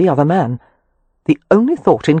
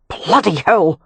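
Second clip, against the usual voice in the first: exclamation; 3 semitones higher, slower than usual. A narrator's reading. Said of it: "Rowan exclaimed".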